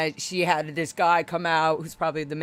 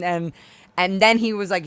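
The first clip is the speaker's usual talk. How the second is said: ordinary delivery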